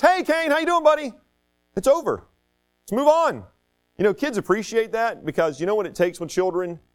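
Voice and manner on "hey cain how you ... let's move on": put-on high-pitched, upbeat hello to a kid; friendly greeting. Teacher greeting a student in the hallway, friendly and forgiving. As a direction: greet him as if nothing happened.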